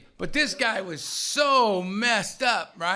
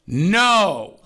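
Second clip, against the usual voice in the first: loud, drawn-out no; shouted from afar; 2 semitones higher, 8 dB louder than usual. Retold or imitated. Sponsor shouting from three rooms over, forbidding. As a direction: holler a long forbidding no. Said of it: "three rooms over, you heard".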